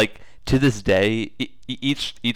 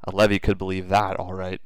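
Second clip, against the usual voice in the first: usual speaking voice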